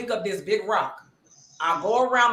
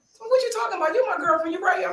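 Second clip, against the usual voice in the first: higher-pitched mock innocent boyfriend; imitating Javon; 9 semitones higher, faster than usual. Javon dodging the girlfriend question, mock innocent and higher. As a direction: play the caught liar sweet-talking.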